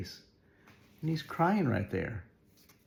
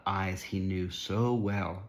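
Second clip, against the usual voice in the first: ordinary delivery, nothing marked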